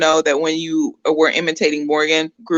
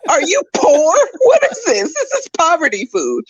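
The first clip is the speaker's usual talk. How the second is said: put-on snooty baby; 9 semitones higher than usual. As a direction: play the baby as an outraged aristocrat.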